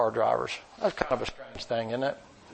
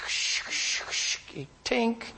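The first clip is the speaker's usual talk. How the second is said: imitated scraping and tink; 10 semitones higher, much slower than usual.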